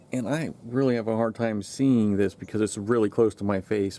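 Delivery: ordinary talk, nothing marked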